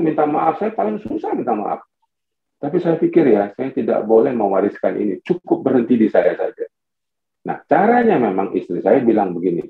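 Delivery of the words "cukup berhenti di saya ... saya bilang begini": ordinary talk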